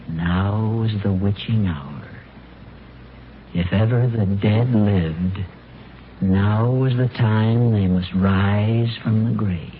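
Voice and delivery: slow, hushed ghost-story reading; slow eerie reading of the article; spooky storytelling. Acted. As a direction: savor each line like a campfire tale.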